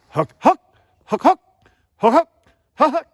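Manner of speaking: HOOK jumping up high and loud. Shown as a demonstration. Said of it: "I'm actually higher in making my pitch, my second note".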